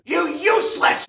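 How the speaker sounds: ordinary talk, nothing marked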